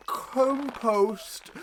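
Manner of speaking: loud high villain laugh; evil cackle. Acted. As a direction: cackle at your own pun. Villain pun then laugh.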